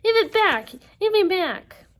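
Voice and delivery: high, loud furious demand; yelling. Acted. Wasp to her sister as she swerves, furious yelling. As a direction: yell for it back at full pitch.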